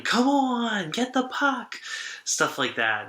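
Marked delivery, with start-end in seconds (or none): 0.0-1.8 mock fan yell, pitched higher; imitating a yelling fan. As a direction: ham up a rowdy hockey fan's heckle.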